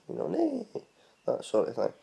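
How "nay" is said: "Nay" squealed very high like a horse; whinny the word "Nay"; horse neigh. Shown as a demonstration. Comparing the Scots word to a horse sound, a high whinny. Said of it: "a horse".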